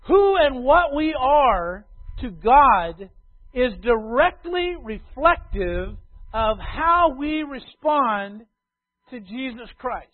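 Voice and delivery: loud, slow, lifted proclamation; big pulpit declaration, drawn out; preaching at full force. Acted. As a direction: proclaim it to the whole congregation. Opening declaration of the sermon.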